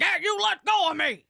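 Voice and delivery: high, loud imitated yell of protest; mock shout. Drunk fan hauled out by security, mock shouting. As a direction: yell it like you're being hauled off.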